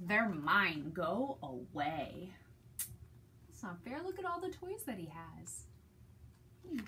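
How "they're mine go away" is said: low, slow greedy pug grumble; grumbling Pig. Acted. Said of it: "Pig would just grumble".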